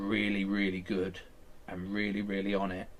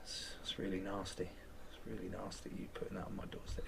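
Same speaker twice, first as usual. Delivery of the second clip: soft, near-whispered reproach; hushed and wounded, almost a whisper; hurt hush; 10 dB quieter than usual. Retold or imitated.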